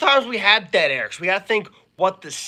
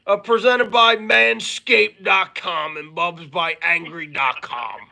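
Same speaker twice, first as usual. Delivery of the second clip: slow, stretched-out mock sponsor announcement; Billy Blank; slower than usual. Co-host plugging the podcast and its sponsors, slow imitation. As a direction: imitate a co-host's grand plug, dragging the words. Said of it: "Billy Blank likes to say".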